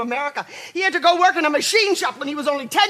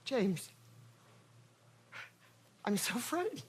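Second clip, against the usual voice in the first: frightened near-whisper; 4 semitones higher, 10 dB quieter than usual. Acted. Mother confides fear to husband.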